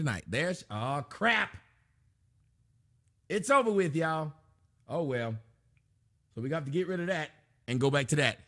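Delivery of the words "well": ordinary talk, nothing marked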